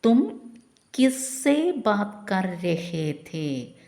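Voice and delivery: slow, careful teacher dictation; slow model sentence. Shown as a demonstration. Teacher modelling the Hindi question slowly for learners. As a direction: spell it out word by word. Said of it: "എങ്ങനെ ചോദിക്കാം?".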